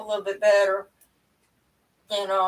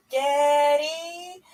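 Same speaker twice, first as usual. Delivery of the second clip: imitating granddaughter; 5 semitones higher than usual. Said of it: "first thing out of her mouth".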